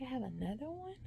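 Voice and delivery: high, hushed question of disbelief; can't believe it's happening again; dismayed disbelief. Retold or imitated. Patient hearing of a second tumor, stunned disbelief.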